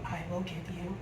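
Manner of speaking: low, hushed offer; retold confidential bribe, dropped in pitch; secretive bargaining. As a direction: lean in and softly cut a deal. Sister leans over to bargain secretly.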